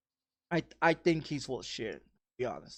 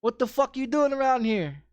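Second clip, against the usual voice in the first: higher, louder insult in Spanish then English; retold angry shout; 8 semitones higher, 4 dB louder than usual. To the stranger lurking outside, a higher, louder retold shout. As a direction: snap back at the stranger, pitch up. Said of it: "I said".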